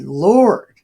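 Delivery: loud cry. Acted. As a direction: Lord called out loud and high. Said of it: "He said".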